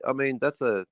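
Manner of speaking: ordinary talk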